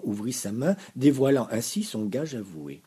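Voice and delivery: ordinary delivery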